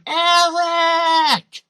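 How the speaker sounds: high, loud drawn-out mock shriek; mock scream. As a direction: scream the name like a hammed-up film damsel. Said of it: "spent all her screen time screaming".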